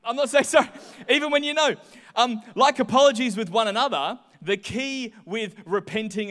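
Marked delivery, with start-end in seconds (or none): 0.0-0.7 higher, louder stubborn refusal. Retold or imitated. Child who realises wrong still refusing, stubbornly louder. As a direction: dig in and refuse even knowing better. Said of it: "still like".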